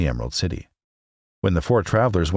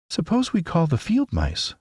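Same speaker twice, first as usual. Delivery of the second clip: much higher, lightly suggesting Dorothy; suggesting girl; 12 semitones higher than usual. A narrator's reading. Dorothy proposing to her friends, light girlish suggestion. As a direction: offer the idea gently, girlish. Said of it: "she suggested".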